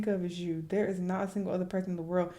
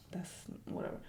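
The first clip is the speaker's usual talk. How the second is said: whispered, much softer; whispered aside; 12 dB quieter, faster than usual. Real, not acted. Aside during the crying text, whispered. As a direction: drop to a whisper. Aside while reading texts.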